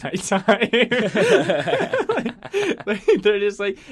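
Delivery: high, loud laughter through the words; real laughter; laughing. Real, not acted. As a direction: crack up while talking. Laughing at own joke.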